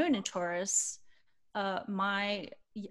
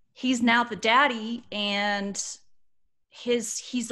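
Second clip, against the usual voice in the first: louder, slow restart after being moved; slow, full-voiced and heartfelt; emotional, regathered; 3 semitones higher, 8 dB louder, slower than usual. Real, not acted. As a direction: push on firmly through the feeling. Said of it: "I'm a little emotional about this".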